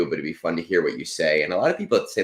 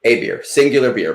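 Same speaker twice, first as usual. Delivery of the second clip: SINGULAR punched higher and louder; emphatic clarification; 6 semitones higher, 7 dB louder than usual. Real, not acted. Host clarifying the gift is one beer, emphatic. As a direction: hit "singular" hard to make it just one.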